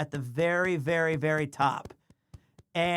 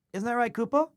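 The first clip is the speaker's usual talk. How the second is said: cooing pet talk; 6 semitones higher, faster than usual. Real, not acted. Owner cooing to his cat Kupo on stream, sing-song baby talk. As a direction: coo it to the kitten.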